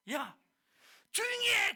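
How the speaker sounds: loud, high-pitched hushing yell; imitated angry silencing; scolding shout. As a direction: shout him down as the crowd would. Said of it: "사람들은 그를 꾸짖는 거예요".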